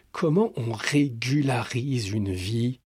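usual speaking voice

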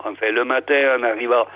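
ordinary delivery

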